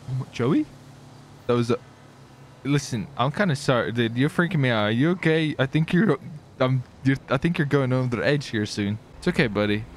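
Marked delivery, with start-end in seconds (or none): none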